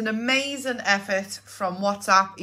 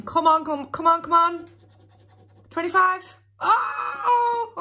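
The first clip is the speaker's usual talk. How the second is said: high, urgent come on; excited pleading; 8 semitones higher than usual. Real, not acted. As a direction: urge the card on, rising. Urging the last number.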